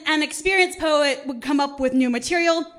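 normal talking voice

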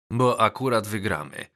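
ordinary delivery, nothing marked

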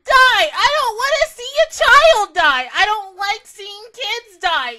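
high loud pained pleading; anguished yelling. Real, not acted. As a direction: cry out at the screen, hurting. Pleading with character onscreen.

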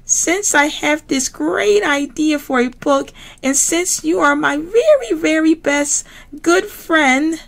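very high squealy piggy voice; Miss Piggy voice. Acted. Miss Piggy to classmates, very high pig voice. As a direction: play the diva pig, high and bright.

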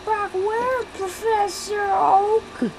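high, slow mock question; high character voice. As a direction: play along in a squeaky voice. Replying in character.

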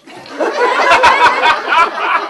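laughter. Real, not acted. Audience laughing at punchline.

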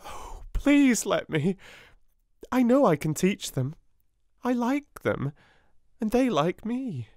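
put-on girl begging to teach the little ones; eager little girl. A narrator's reading. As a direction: beg brightly to be allowed. Sarah pleading to teach.

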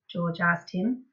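plain reading voice

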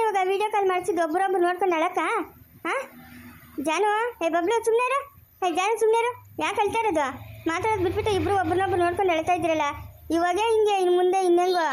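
put-on a mother raising her voice at two crying children; loud scolding, high pitched.

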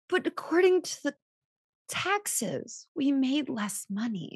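high, whining mock protest; a hammed-up plaintive objection; whiny protest. Wife arguing with husband about the taxes, high whiny protest. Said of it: "And I was like".

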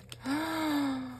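long, high, soft gasp; surprised gasp. Real, not acted. Finding a gift card in the mail, a surprised gasp. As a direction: suck in a long breath of surprise.

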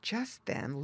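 usual speaking voice

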